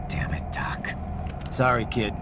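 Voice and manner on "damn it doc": hushed under-the-breath curse; whispered curse. Acted.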